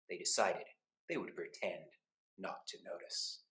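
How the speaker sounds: soft, fading to a whisper; conspiratorial hush. A narrator's reading. Narrating a sneaky plan.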